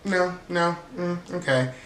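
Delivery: high dodging deflection; evasive Mary. Retold or imitated. Mary dodging Britt's questions about dance class, high and evasive. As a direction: brush them off without answering. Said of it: "and Mary's like".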